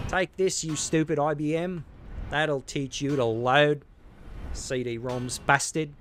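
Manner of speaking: loud, slowed mock rage at the machines; hammed-up smashing insults; mock fury. Smashing panels like hated computers, mock furious.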